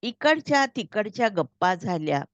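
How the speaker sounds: plain reading voice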